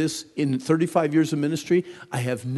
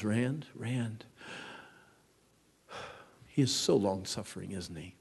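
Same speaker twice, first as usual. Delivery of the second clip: gentle sigh; 3 semitones lower, 8 dB quieter than usual. Pretend. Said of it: "God looks down and says".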